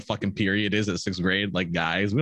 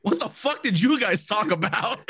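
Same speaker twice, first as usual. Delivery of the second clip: higher, laughing through the question; laughing disbelief; 9 semitones higher than usual. Retold or imitated. Retelling the boys asking the crying girls, laughing. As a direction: ask it while cracking up. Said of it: "all the guys walking like".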